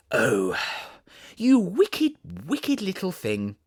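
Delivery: high, loud playful scolding cry; Alice's chiding cry. Acted. Alice scolding the kitten in the hearth rug tangle, a high playful cry. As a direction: cry out at the naughty kitten in fond reproach. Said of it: "cried Alice".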